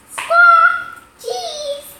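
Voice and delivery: loud, high parrot squawk. Acted. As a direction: screech the word back like a parrot. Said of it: "Polly repeats".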